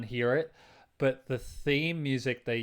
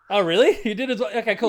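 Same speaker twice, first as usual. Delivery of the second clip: delighted laughing surprise; 8 semitones higher, 10 dB louder than usual. Real, not acted. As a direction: laugh while asking. Surprised delight at shared experience.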